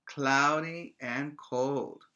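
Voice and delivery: slow "Cloudy and cold", every word drawn out; slow and clear. Shown as a demonstration. Teacher answering her own question for kids, slow and clear. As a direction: spell it out slowly for little learners. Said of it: "It's what?".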